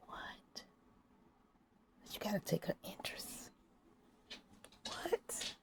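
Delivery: soft, voiceless whispering; whispered bit. Pretend. Confiding to the audience, whispering. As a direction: keep it all in a hushed whisper.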